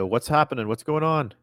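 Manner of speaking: half-hearted yell to people below; mild yell. Pretend. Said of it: "yell out".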